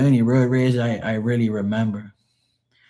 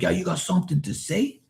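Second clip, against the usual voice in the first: imitating tough guy; 8 semitones higher, 5 dB quieter than usual. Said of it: "He was like".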